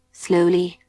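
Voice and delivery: plain reading voice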